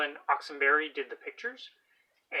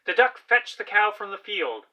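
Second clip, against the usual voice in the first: put-on loud, lively story narration for children; storybook read-aloud; 7 semitones higher, 13 dB louder than usual. A narrator's reading. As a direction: read it out big for the class.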